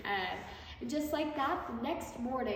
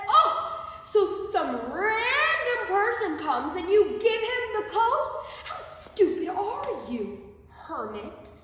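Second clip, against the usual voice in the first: louder, higher, slow outraged scolding; furious king; 8 semitones higher, 8 dB louder, slower than usual. A narrator's reading. King furious that the hermit gave away the coat, loud angry scolding. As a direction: let the fury out. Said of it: "King Karna grew furious and said".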